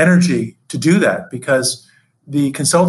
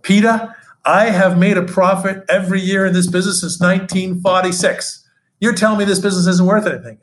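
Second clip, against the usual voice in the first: lightly voiced, higher-pitched Boston accent; a hint of an indignant Bostonian; 6 semitones higher than usual. Old Boston owner protesting to his adviser, in a light Boston accent. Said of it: "in his Boston accent".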